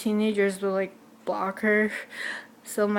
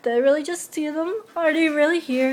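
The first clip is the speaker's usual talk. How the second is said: disbelieving excitement; 6 semitones higher than usual. Retold or imitated. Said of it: "So I'm like".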